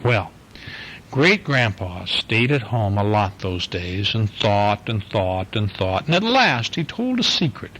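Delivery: gentle storybook read-aloud; reading to a child. Acted. Dad reading the Easter bunny book to his little girl, easy storytelling voice. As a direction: read it like a bedtime picture book for a little one.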